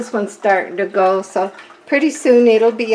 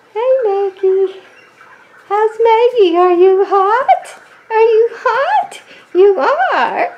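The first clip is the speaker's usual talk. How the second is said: high, cooing pet voice; talking to a dog; 12 semitones higher than usual. Real, not acted. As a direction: coo sweetly at the dog. Greeting the dog Maggie.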